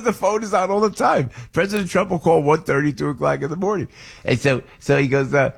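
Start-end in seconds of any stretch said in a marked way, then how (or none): 0.0-3.9 a lightly done Trump, higher pitched; hint of Trump bravado, retold; a touch of Trump swagger, pitched up; Trump impression. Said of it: "Mike Johnson doing a great Donald Trump impression".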